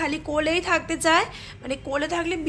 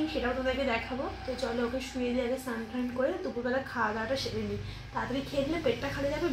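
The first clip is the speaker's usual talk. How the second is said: hushed voice; 8 dB quieter than usual. Real, not acted. Baby just fell asleep nearby.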